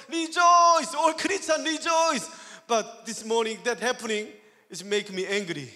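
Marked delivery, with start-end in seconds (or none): 0.1-2.3 raised, loud rallying cry; high, shouted pulpit call; preaching exhortation. Retold or imitated. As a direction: proclaim it to the whole congregation. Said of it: "I have to preaching".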